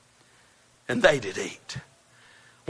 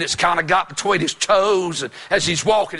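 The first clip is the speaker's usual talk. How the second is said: ordinary delivery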